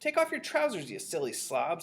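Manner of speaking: high call dropping to a scolding finish; shouted. A narrator's reading. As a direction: call out an impatient order. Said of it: "someone else shouted".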